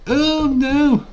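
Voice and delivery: high loud whining taunt; mock crying chat. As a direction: wail like the gloating chat.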